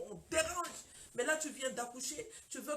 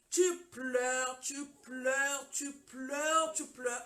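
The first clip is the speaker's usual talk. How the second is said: loud, held-out words, hammered in turn; emphatic repetition; 6 dB louder, much slower than usual. Shown as a demonstration. Preacher tells hurt wives about endless crying, loud and drawn out. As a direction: stretch each repeat and push it out.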